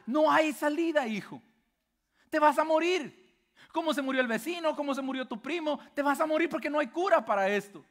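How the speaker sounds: más agudo y fuerte, lento al inicio, como padre sin esperanza; padre desesperado. Acted. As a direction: dile al hijo que no hay salida, angustiado. Padre desesperado ante hijo mordido. (el padre le dice al hijo que va a morir, angustiado)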